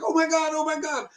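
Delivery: high-pitched flurry of amazement; squealed mock excitement; excited wife. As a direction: imitate a thrilled wife, voice way up. Acts out wife's excited reaction.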